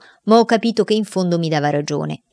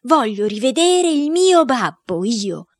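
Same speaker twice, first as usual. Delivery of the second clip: put-on higher, slower child cry to be let in; a boy pounding on the door, calling out; boy yelling at door; 7 semitones higher, slower than usual. Boy yells to be let in to see his father. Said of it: "urlando a squarciagola".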